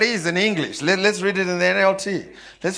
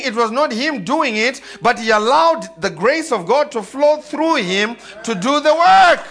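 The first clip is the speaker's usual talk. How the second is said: loud, high-pitched sermon climax; full-force preaching; 7 semitones higher, 4 dB louder than usual. Acted. Preacher drives home grace and labor to the church, full-force preaching. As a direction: drive it home, building to a shout on the last words.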